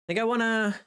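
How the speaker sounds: usual speaking voice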